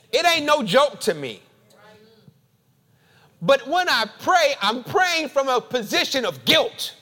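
loud, rising spoken-word sermon; fervent preaching. Acted. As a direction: preach it with building fire.

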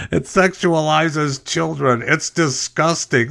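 slow, high-pitched indignation; exaggerated outrage, rising to an exasperated retort; mock outrage. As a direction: stretch it out, high and scandalized. Mimics outraged objectors, then retorts.

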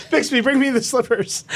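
high-pitched, hammed-up command through laughter; giggly mock-imperious order; ordering a butler. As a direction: call for the servant, amused by your own act. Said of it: "sound like you're yelling at a butler".

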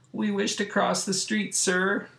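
high, small put-on boyish voice; mock-meek polite plea; timid request. The boys ask Robot Man to cross, meekly.

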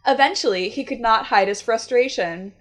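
ordinary talk, nothing marked

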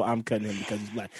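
the real meaning slipped out fast and quietly; muttered under breath. Shown as a demonstration. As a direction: mumble it low and quick like a secret confession. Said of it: "if it felt a little".

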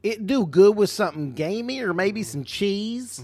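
high goofy voice, hamming up the slip; mock dumb voice. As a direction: play it like a lovable oaf. Repeats own slip in mock voice.

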